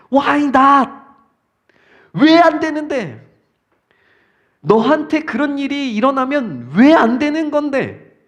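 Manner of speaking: loud, high, challenging retort; answer from heaven. Acted. Heaven answering the protesting Viking, challenging retort. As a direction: fire the question back, ringing out. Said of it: "하늘에서 이렇게 대답이 와요".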